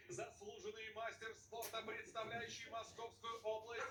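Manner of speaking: slow, drawn-out ring introduction; boxing ring announcer. Real, not acted. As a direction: announce the fighter to the arena. (объявление ринг-анонсера, растянуто)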